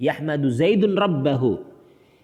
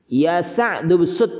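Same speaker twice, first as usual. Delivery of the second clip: "Ya Sa'du bsut" called out louder and slowly; model answer read out; 3 semitones higher, 5 dB louder, slower than usual. Shown as a demonstration. Teacher reads the drill answer aloud to students. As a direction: call the answer out firmly and slowly to the class. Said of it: "Seperti itu".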